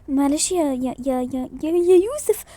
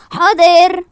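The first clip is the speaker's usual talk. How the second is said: loud, punched-out reply; loud shouted answer; loud call; 11 dB louder, much slower than usual. Acted. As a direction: call it out at full voice. Calling out agreement.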